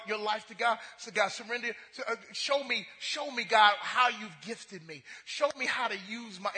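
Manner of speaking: ordinary talk, nothing marked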